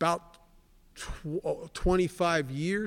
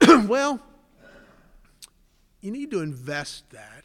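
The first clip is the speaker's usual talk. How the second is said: tempting bad inner voice; 3 semitones higher, 8 dB louder than usual. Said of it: "my head said to me".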